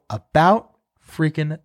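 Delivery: mock weary about freaking time; drawn-out exasperation. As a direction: stretch every word, then let it sink away. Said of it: "I go".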